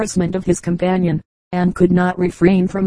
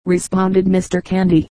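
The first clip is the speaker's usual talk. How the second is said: ordinary delivery, nothing marked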